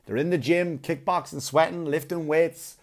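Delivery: usual speaking voice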